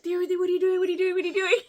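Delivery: put-on high, insistent kids' chant; high mocking kid voice. Kids calling out from next door, high and nagging. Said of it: "and they're like".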